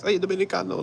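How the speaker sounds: high-pitched mocking Dominican accent. Camp leader greeting a New Yorker, mock Dominican accent. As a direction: imitate someone taunting in fake Dominican accent. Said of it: "trying to mock me like I have an accent".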